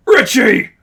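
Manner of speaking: high, loud anguished yell; furious shout through pain. Acted. Miles betrayed by Richie, furious pained yell. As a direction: cry out betrayed. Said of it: "Miles spoke through grinding teeth".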